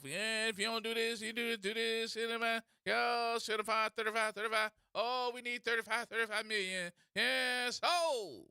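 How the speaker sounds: steady chanting patter rolling into numbers; auctioneer imitation. Comedian mocking someone as taking bids, rattling auctioneer chant. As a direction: call bids like a mock auctioneer. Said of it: "sounded like you was damn auctioning something off".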